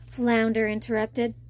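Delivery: usual speaking voice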